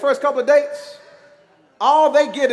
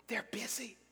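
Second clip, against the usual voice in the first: put-on hushed child's whisper; whispering little boy; 20 dB quieter than usual. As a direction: whisper it like a small boy hiding. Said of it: "He was whispering".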